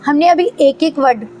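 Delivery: ordinary talk, nothing marked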